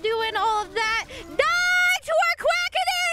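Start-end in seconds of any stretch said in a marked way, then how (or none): 1.1-3.1 high, slow battle cry. Pretend. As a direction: yell it like a charge.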